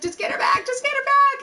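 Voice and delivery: retold panic. Woman retelling begging husband to get friend back, panicking. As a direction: replay a panicked plea, pitch climbing. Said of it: "I'm freaking the fuck out".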